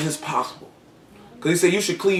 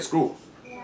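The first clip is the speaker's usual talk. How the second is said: a hushed, careless brush-off; whispered aside; 10 dB quieter than usual. Acted. Husband shrugging off his wife, whispered. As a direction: whisper it dismissively, under the breath. Voicing a faithless husband's thought.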